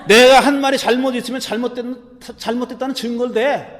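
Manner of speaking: defiant. Acted. Jesus answering the man who hit him, defiant. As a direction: demand proof from the one who struck you. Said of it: "이렇게 대들었어요".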